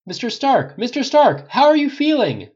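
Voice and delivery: high, loud press shout; urgent high-pitched calling; reporter calling out. A narrator's reading. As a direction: shout to get his attention over a crowd. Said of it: "the reporter calls".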